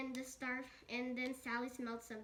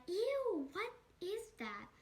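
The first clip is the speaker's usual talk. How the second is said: high-pitched recoil of disgust; playfully grossed out, pitch raised; acted disgust; 7 semitones higher, slower than usual. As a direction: start on a high, revolted ew. Acts out disgust at the smell.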